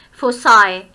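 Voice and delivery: foci spoken the American way, FOH-sye with an s-sound instead of k; American pronunciation. Shown as a demonstration. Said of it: "but in American English ... pronounced as foci".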